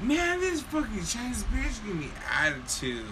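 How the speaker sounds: high-pitched mock outrage; indignant complaint. Friend griping about the waitress's attitude, indignant.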